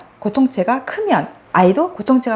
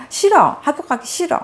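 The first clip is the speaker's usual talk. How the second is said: higher, mock whining kid; whiny child; 6 semitones higher than usual. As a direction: pout it out like a child refusing school. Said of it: "아이가 ... 얘기해요". (떼쓰는 아이 흉내)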